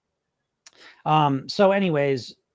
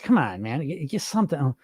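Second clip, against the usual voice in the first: ordinary talk, nothing marked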